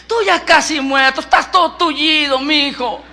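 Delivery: teasing wife pointing out the obvious; mock him with affectionate exasperation; burla incrédula. [name] se burla de su marido. (esposa burlona e incrédula)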